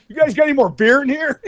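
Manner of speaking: high, loud, cheeky mooch voice; drunk guest. Retold or imitated. Voicing himself as drunk guest.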